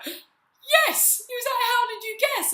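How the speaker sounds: very high, loud amazed squeal; excited shriek. Retold or imitated. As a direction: squeal with shocked delight. Excited stranger quoted after gasp.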